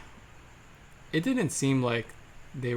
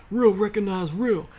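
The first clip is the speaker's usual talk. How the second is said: high, loud imitated chant; shouting; 9 semitones higher, 7 dB louder than usual. Imitating two guys shouting at each other's faces, loud shout. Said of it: "kept just shouting at each other's faces".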